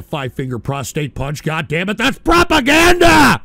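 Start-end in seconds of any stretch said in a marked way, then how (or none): 2.0-3.4 sudden high, loud yell; indignant outburst. Acted. Host erupts at a donor's message, loud outraged yell. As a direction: blow up on the last word.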